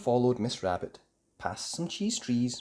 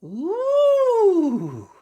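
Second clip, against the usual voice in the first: very high, loud wondering ooh; amazed ooh; 11 dB louder than usual. Acted. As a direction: marvel together. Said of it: "cried Mandy and her friends".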